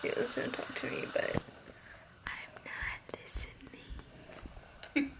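soft playful whisper. Real, not acted. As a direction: breathe it teasingly. Teasing, ignoring him.

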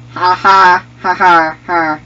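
loud, punched-out "ha ha"; gloating mock laugh. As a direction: crow with staged laughter. Gloating laugh after a kill.

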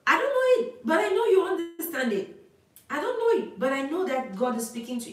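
fervent outcry. Real, not acted. Prophet crying out to the congregation, in a high, loud fervent cry.

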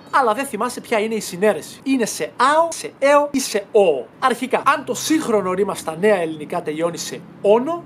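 usual speaking voice

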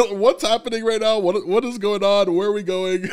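lightly acted flurry of questions; agitated group chatter. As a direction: imitate puzzled followers chattering over each other. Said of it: "they're all kind of chatting".

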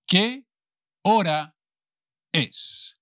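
"Qué... hora... es" in separate pieces; palabra por palabra. Shown as a demonstration. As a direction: say "Qué hora es" one word at a time, long pauses. Teacher models question word by word. (palabra por palabra, con pausas largas)

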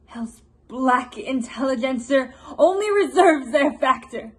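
loud, forceful denunciation; Queen Margaret. Acted. Vengeful queen denouncing hell's agent, loud and declamatory. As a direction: declaim it like a curse. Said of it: "a portion of Queen Margaret's monologue".

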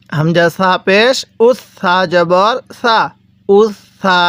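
slow spelling, then উস সা joined with a long held s; doubled consonant. Shown as a demonstration. Teacher showing learners how to read it, slow with doubled s. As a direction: hold the s between উস and সা. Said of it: "তাহলে কিভাবে পড়ব আমরা?".

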